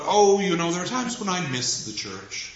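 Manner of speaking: high, airy, mock-pompous musing; pontificating. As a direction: hold forth in a lofty, high-pitched voice. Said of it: "is pontificating about".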